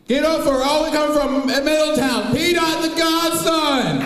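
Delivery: high, loud hype-man announcing; announcer hype intro. Acted. Comedian announcing himself to the crowd, loud hype intro. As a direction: call yourself on like an emcee. Hyping his own walk-on intro.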